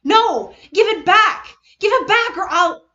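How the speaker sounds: high, loud desperate cries; frantic yelling. Acted. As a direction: scream at him to give it back. Maki frantic, grabbing.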